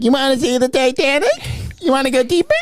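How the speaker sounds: high taunting villain voice; high creepy voice. Retold or imitated. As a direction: lure them down, teasing.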